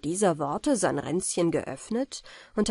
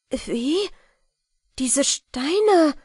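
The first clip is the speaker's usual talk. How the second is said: high, loud, taken-aback question; startled cry; 8 semitones higher, 6 dB louder, much slower than usual. A narrator's reading. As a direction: cry out, struck by the sight. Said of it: "fuhr sie betroffen zurück". (betroffen ausrufend)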